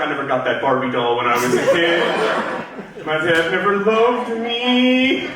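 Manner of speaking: hammed-up self-pity, dragging out the last words; exaggerated woe-is-me lament; mock sad audience. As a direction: wail it slowly like a wounded grown-up kid. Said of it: "room full of people who are like".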